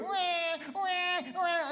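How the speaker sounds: mock baby wail, ending high; mock baby crying. Preacher imitating a crying baby during prayer, mock wail. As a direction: wah wah wah like a fussing toddler. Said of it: "your kid's going".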